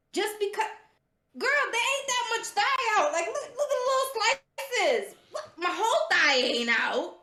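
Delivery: high, loud, incredulous outburst; exasperated protest. Real, not acted. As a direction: voice shoots up, half laughing. Exasperated defence of her outfit.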